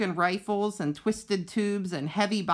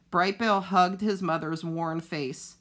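ordinary delivery, nothing marked

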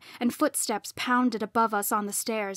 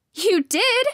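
high, loud accusation; angry high-pitched shout; yelled; 11 semitones higher, 8 dB louder than usual. A narrator's reading. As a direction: yell the blame straight back. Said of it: "she yelled".

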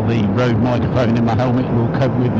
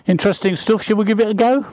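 excited; 10 semitones higher, faster than usual. Real, not acted. Eager to start the ride.

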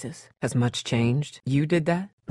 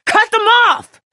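high, loud screamed order; desperate shout; 11 dB louder, slower than usual. Acted. Survivor screaming at the group to cut off zombie arms, desperate shout. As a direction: scream it with everything.

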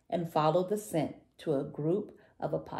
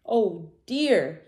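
dismayed little dinosaur, pitched up; a louder, higher storybook sigh; 4 semitones higher, 6 dB louder than usual. A narrator's reading. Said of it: "he sighed".

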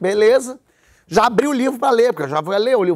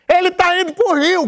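retold shout; 10 semitones higher than usual. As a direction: yell it across the plane cabin. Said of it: "Gritou meio alto".

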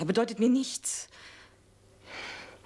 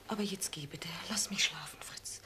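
much softer, frightened whisper; scared wife whispering to send her husband away; pleading whisper; 5 semitones lower, 10 dB quieter than usual. Acted. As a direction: beg him quietly to leave.

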